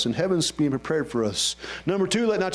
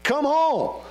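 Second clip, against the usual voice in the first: Jesus calling home; 12 semitones higher than usual. Retold or imitated. Preacher voicing Jesus calling believers home. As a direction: call the children home from far above. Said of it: "he's gonna say something like this".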